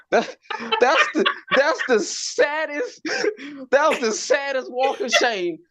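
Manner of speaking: high, laughing wail; comic laughing at his own walk of shame. Real, not acted. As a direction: crack up while saying it. Laughing at own sad exit.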